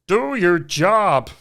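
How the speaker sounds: slow, drawn-out commanding impression; Jordan Peterson. Shown as a demonstration. Demonstrating his lead-in phrase, slow guru impression. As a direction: stretch each word like a stern guru. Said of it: "leading phrase to try and do".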